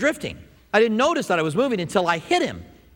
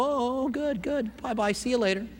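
soft, high, placating brush-off; politely humoring; 5 semitones higher, 6 dB quieter than usual. Acted. Skeptic edging away from a friend's angel story, politely humoring. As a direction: humor them in a small high voice and ease away. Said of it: "I might just go".

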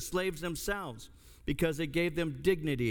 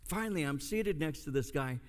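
ordinary talk, nothing marked

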